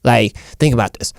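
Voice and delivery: normal talking voice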